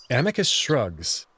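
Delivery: plain reading voice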